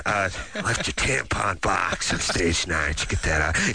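low, slow announcer voice; mock sultry club DJ on the mic; lightly hammed-up DJ purring into the mic; strip club DJ.

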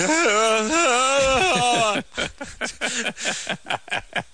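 high strangled sleep cry, long and falling; strangled dream yelp. Retold or imitated. Host imitating his own humiliating sleep-yell. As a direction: imitate a choked nightmare bellow. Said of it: "I was like".

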